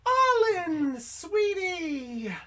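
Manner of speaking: put-on high, sweet motherly girl voice. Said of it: "the girl looks at you and goes".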